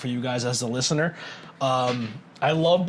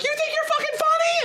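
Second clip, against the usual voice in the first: much higher, squeaky echo of an angry mom; mimicked toy echo. Cactus toys repeating the angry mom, high squeak. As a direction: parrot the line in a tinny toy pitch. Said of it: "the mom's like".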